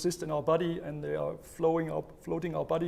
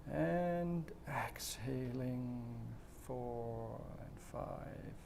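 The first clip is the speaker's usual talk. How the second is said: slow, soft breathing-exercise guidance with long pauses; guided breathing; 8 dB quieter, slower than usual. Shown as a demonstration. Coach leading viewers through slow breathing, calm and soft.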